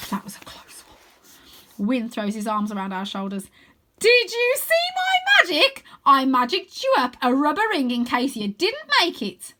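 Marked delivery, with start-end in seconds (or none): 0.1-1.9 soft, slow relieved whisper; whispered relief after a near miss. Acted. 4.0-9.5 put-on much higher, louder boastful kid voice; bragging young wizard; proud boy wizard.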